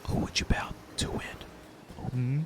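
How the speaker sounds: a soft breathy whisper in the ear. A narrator's reading. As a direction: lean in and whisper it. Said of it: "leans in and whispers in your ear".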